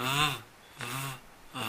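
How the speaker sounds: the voiced th buzz on its own, repeated; voiced th sound. Shown as a demonstration. Teacher isolates the voiced th sound. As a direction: hum the th with the tongue between the teeth. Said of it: "El sonido es".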